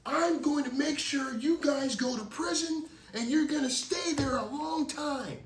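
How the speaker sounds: high, loud threatening yell, slowing at the end; furious neighbor vowing prison; angry old neighbor. Retold or imitated. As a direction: bark the threat at the kids. Angry neighbor Rick threatening kids.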